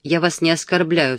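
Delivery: ordinary delivery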